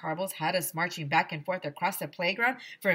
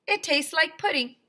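put-on higher kid voice, pleased; happy Ryan; 10 semitones higher, 5 dB louder than usual. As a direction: sell it to the others. Ryan praises food.

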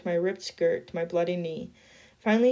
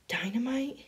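soft, awed breath on Dynamite?; breathed in awe; 4 semitones higher, 6 dB quieter than usual. A narrator's reading. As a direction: breathe it out, amazed. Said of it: "he breathed".

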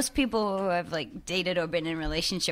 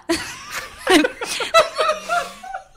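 loud high laugh; high burst of laughter; 5 dB louder than usual. Real, not acted. Big laugh at question.

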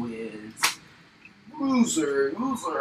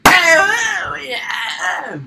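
high loud triumphant yell; excited shout; 12 semitones higher, 8 dB louder than usual. Pretend. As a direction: cheer it out. Cheer in fight.

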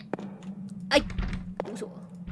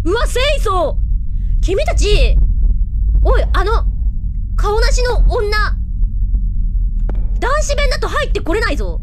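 higher and louder, gleeful teasing; excited loud taunting; 4 semitones higher, 7 dB louder than usual. Real, not acted. Streamer taunting the ghost from the men's toilet, loud gleeful teasing. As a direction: crow at the ghost who can't follow.

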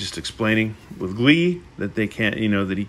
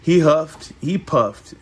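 slow, drawn-out storybook reading; storybook narration; slower than usual. A narrator's reading. Wolf threatens to blow the house in, slow storybook narration. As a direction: stretch the huffing and puffing for effect.

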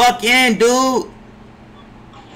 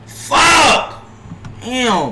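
angry shout; 5 semitones higher than usual. Real, not acted. Streamer watching a teammate blow the play, furious yell. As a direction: yell it at the screen. Teammate gives away the ball.

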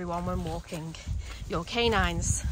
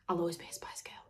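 soft whispered joke; whispered aside; 2 semitones lower, 8 dB quieter, faster than usual. Real, not acted. Vlogger joking about her mug, whispered aside.

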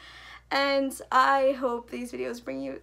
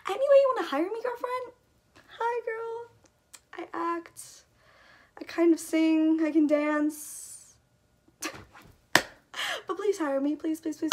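much higher, cutesy self-promotion; playful pitch; 5 semitones higher, 3 dB louder than usual. Real, not acted. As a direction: sell yourself with a teasing sing-song lilt. Pitching herself to be hired.

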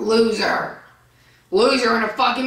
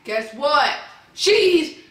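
angry rant; 7 semitones higher than usual. Real, not acted. Ranting at aunt in comments.

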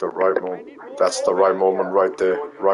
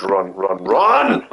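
urgent shout; much slower than usual. Real, not acted. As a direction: shout it, rising sharply on the last word. Urging escape in the game.

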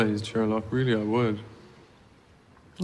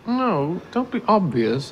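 ordinary talk

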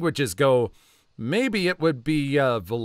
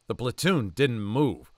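usual speaking voice

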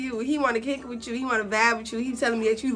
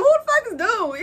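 even higher, incredulous; pitched-up mock outrage; baffled again; 11 semitones higher than usual. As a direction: throw the question up high, stunned. Repeating her confused reaction.

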